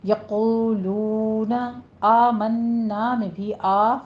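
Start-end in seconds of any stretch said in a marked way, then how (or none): 0.0-1.6 यक़ूलूना slow, heavy क़ and round long ऊ; यक़ूलूना drawn out with a thick क़; rounded lips. Shown as a demonstration. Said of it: "होंठों को पूरा गोल कर लीजिए".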